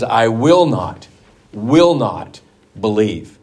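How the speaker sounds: slow, hammering WILL NOT twice; emphatic double negative. Retold or imitated. Thomas refusing to believe without proof, slow and emphatic. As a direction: plant your foot on "will not", slowly. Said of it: "he's very emphatic".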